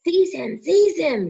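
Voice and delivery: high, loud shriek. A narrator's reading. Voldemort ordering Quirrell again, a high loud shriek. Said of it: "shrieked Voldemort again".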